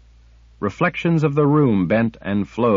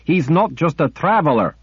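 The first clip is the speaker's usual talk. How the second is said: angry shout; 8 semitones higher, 3 dB louder than usual. A narrator's reading. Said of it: "Kara screamed in rage".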